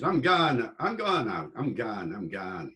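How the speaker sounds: northern English "gaan" for going; Yorkshire accent. Acted. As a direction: mimic Yorkshire locals, trailing away. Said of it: "up north in Yorkshire and places".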